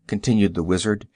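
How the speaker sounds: plain reading voice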